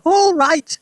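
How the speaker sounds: put-on high, loud outraged old woman; indignant grandma yelling high; yelled. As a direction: shriek the question back. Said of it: "she yelled".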